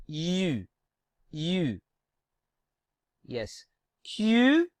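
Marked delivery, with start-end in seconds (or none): 0.1-1.8 U, lips forward, teeth nearly shut; French U sound. Shown as a demonstration. Teacher showing students the French U, teeth almost clenched. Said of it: "We have to keep our teeth almost clenched and try to say U".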